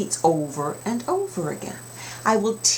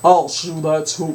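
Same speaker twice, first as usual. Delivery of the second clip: Papa; 3 semitones lower, 5 dB louder than usual. Acted. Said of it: "Papa said".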